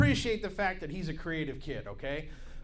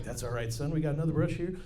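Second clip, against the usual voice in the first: Bob Ross voice; 4 semitones lower, 3 dB quieter than usual. Retold or imitated. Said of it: "I was like".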